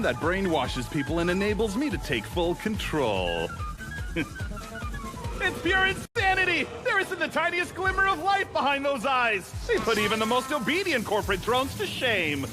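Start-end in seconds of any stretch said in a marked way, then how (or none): 5.4-9.5 high, exhilarated villain raving; manic gloating. Acted. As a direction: marvel madly at your own scheme. Boss gloats over brainwashed workers.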